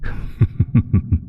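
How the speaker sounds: voiceless sinister chuckle; breathy evil chuckle. Acted. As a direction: laugh under your breath at their fate. Patron threatens possession.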